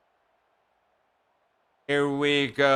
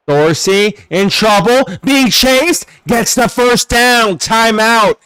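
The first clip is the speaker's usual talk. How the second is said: tense play-by-play; 5 semitones higher, 7 dB louder, slower than usual. Real, not acted. Streamer calling Dorsey being chased, building play-by-play. Live call of chased quarterback.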